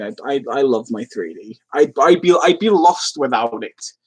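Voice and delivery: usual speaking voice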